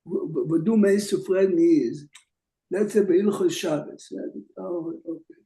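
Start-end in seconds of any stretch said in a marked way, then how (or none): none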